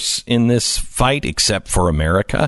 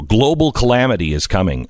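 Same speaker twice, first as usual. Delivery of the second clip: ordinary talk, nothing marked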